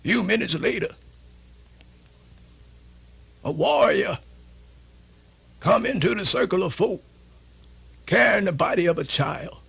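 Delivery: usual speaking voice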